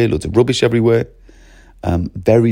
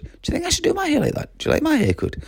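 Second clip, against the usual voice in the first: much higher, fast and softer, playful fishing for compliments; mock enthusiastic teacher; 4 dB quieter, faster than usual. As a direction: tease the kid with jolly banter. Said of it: "The same old nonsense".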